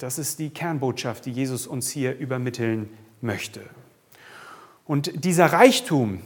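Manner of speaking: ordinary delivery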